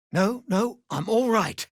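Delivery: normal talking voice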